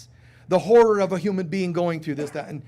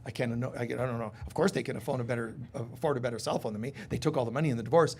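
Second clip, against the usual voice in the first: fast, softer, lower resentful mutter; bitter grumble; 6 semitones lower, 5 dB quieter, faster than usual. Retold or imitated. Divorced listener resenting the ex, fast low grumble. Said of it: "part of you is gonna be like".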